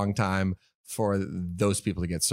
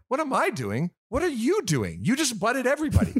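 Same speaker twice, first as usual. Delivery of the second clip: high, indignant "what am I doing"; mock outraged yell; 12 semitones higher than usual. To line-cutters, a high outraged retort. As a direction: throw the question back at them, outraged. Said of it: "I'm just yelling at this point".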